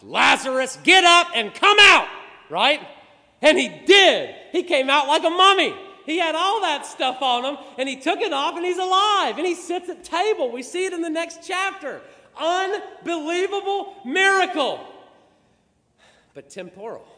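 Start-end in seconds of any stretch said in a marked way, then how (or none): 0.0-2.1 high, loud summons; commanding shout. Acted. Jesus calls the dead man out. 2.5-14.9 loud, high, exultant sermon; fired-up preacher telling of a wonder; full-force preaching. Acted.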